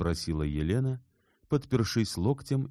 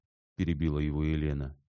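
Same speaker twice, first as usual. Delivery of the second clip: plain reading voice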